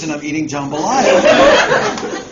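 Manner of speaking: ordinary delivery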